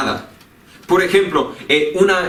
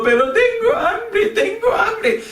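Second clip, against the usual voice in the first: very high, slow, drawn-out whine; hammed-up child's protest, very high and slower; whining little daughter, more insistent; slower than usual. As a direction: keep whining like a hungry child who won't let up. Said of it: "siguen quejando y protestando".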